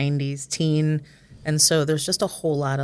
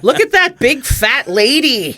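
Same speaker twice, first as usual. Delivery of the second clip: high, slow, loud shout; shouting kid; 9 semitones higher, 7 dB louder, slower than usual. Retold or imitated. Somebody's kid pointing out a shopper, shouting. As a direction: imitate a bratty kid shouting it out. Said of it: "he just shouted".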